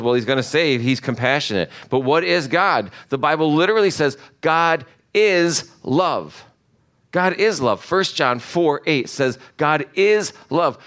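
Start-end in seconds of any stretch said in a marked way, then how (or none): 4.4-6.4 "is" set apart and punched; stress on IS. Real, not acted. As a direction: lean hard on "is", spacing the words. Stresses "is" to define God.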